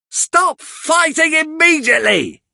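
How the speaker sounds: very high, commanding shout; high shrieked order from the angry mistress; Missus Sowerberry, shouting. Acted. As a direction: stop them dead with one order. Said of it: "she shouts".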